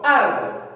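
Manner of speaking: "Árbol" with a firm punch on "Ár"; stressed first syllable. Shown as a demonstration. Teacher confirming where the voice is strongest, ÁR-bol in "Árbol". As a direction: hit the first syllable of "Árbol", let "bol" fall away. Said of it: "la mayor fuerza de voz".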